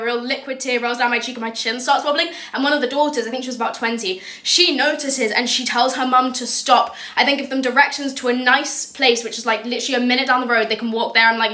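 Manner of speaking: ordinary talk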